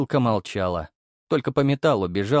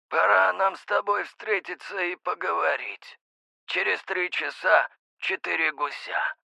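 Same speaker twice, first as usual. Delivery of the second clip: metallic voice; 8 semitones higher than usual. A narrator's reading. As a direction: speak as through a metal tube. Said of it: "Металлический, словно через трубу говорили".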